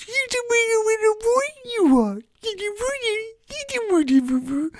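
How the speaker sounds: very high, tiny cooing voice; squeaky baby talk. Acted. Owner fusses over good little dog, squeaky doting. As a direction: dissolve into squeaky pet-owner gibberish.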